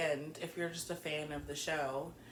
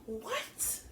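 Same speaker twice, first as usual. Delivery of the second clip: stunned whisper; 7 semitones higher, 3 dB quieter than usual. Real, not acted. Bewildered at his choice.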